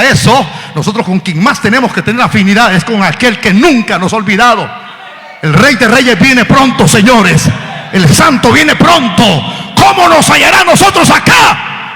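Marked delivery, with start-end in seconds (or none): 5.4-11.9 loud, high preacher's shout; preaching at full force. Acted. Preacher warning the church, full-force preaching. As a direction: build to a cry. Sermon climax.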